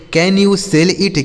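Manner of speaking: slow, careful English question; slow model reading. Shown as a demonstration. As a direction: read it out slowly for learners. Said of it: "slow model sentence".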